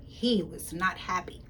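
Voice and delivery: plain reading voice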